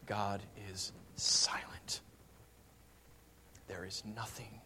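hushed slow whisper; a solemn hushed whisper. Real, not acted. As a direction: drop to a whisper on the silence.

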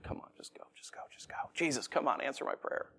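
near-whispered, pressing prayer; urgent hushed plea. Acted. A worshipper in an emergency begging Jesus to come on, hushed urgent plea. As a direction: hurry Jesus along under your breath. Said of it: "kind of an emergency and you're like".

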